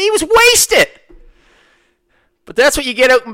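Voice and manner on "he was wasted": much higher, squeaky amazed cry; incredulous exclamation. Real, not acted. The narrator marvelling to listeners at the drunk rider, very high. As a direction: squeal it in disbelief.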